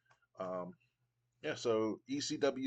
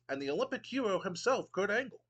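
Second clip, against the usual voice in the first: a hint of a haughty British commissioner, pitched higher; pompous Regal; 8 semitones higher than usual. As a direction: sneer down at the crowd with mock refinement. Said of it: "And Regal goes".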